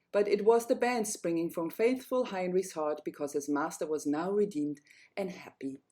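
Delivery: usual speaking voice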